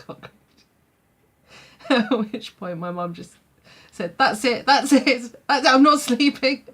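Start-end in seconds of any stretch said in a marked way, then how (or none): 4.2-6.6 loud, high exasperated declaration; fed-up outburst. Retold or imitated. Mum declaring to the family she won't sleep, loud and exasperated. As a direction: throw up your hands and refuse to sleep. Mum refusing after second cockroach.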